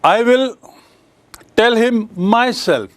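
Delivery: "I will tell him myself" slow and deliberate, "myself" leaned on; stress on myself. Shown as a demonstration. Teacher demonstrating emphasis to his class, slow and pressing. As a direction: press down on "myself" to insist it is you. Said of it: "जब ऐसा हम जोर देते हैं".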